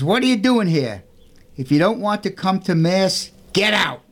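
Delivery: high, harsh voice of a grumpy caretaker; rough, mean caretaker. Acted. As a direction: shoo the boy out sharply. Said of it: "a rough, mean voice".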